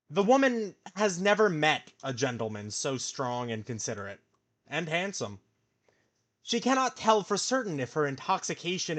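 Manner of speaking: dramatic storybook read-aloud; story narration. A narrator's reading. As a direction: read it like a romance novel. Reads romance narration aloud.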